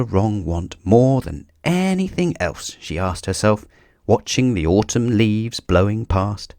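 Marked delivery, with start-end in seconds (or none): none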